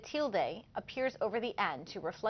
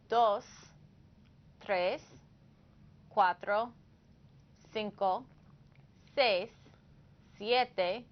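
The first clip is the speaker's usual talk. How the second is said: slow counting drill; slower than usual. Shown as a demonstration. Counting for learners.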